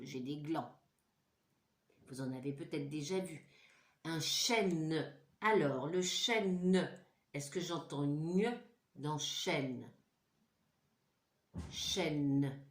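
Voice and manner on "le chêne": normal talking voice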